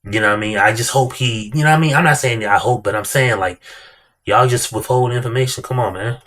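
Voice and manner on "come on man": ordinary talk, nothing marked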